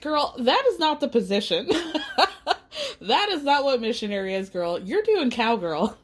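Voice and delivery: laughing mock correction; amused mock protest. Housemates correcting Gabby's charades pose, laughing in mock disbelief.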